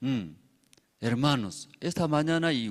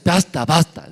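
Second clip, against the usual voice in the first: loud call to stop; 5 semitones higher, 9 dB louder than usual. Acted. Cloud gives up, calls stop.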